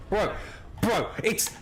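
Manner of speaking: high-pitched exasperated rant at the controller; real frustration. Real, not acted. Streamer yelling at a stuck game character, exasperated and high. Game stick not moving, swearing.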